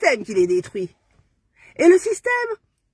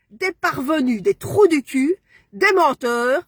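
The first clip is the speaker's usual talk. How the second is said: ordinary talk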